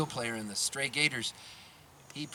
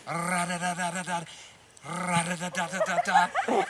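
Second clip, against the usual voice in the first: imitated drum beat; 2 semitones higher than usual. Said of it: "So then he goes".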